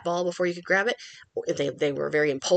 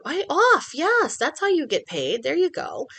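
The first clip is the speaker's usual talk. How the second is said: high, bright praise that slows on yes; excited praise; 10 semitones higher, 4 dB louder, slower than usual. Retold or imitated. Trainer showing how to praise the dog once it is off, high and excited. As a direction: cheer the dog on for getting off. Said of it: "And then I'm like".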